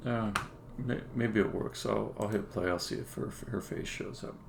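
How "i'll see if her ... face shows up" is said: quiet, half-whispered self-talk; muttering to himself. Real, not acted. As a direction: mumble it under your breath while working. Muttering to himself while testing.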